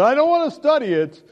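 high, loud no-study; firm refusal. Retold or imitated. Man refuses to study the Bible with his pastor, loud and high. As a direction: shut it down.

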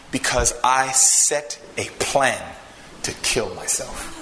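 somber, measured retelling of a confession; grave retold confession. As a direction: weigh each phrase as a painful admission, with long pauses. Voices man confessing suicide plan.

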